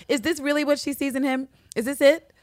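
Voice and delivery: high-pitched incredulous questioning; baffled, can this be all; mock disbelief. Acting out disbelief at the man.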